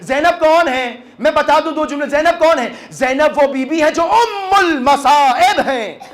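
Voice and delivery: high, fervent, tearful preaching; impassioned majlis lament. Acted. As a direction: cry out the question and answer in grief. Impassioned mourning sermon.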